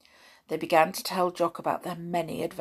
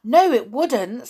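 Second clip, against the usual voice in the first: gasp, then a higher, louder eager protest; 7 semitones higher, 7 dB louder than usual. A narrator's reading. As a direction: jump in keenly. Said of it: "said Jock eagerly".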